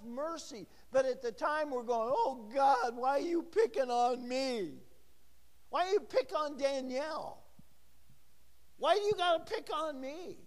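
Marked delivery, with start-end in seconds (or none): 2.1-4.8 hammed-up "poor me" protest; mock fussing. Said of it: "we're just all fussing about". 5.7-10.4 high-pitched, aggrieved pleading; mock fussing. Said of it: "we're just all fussing about".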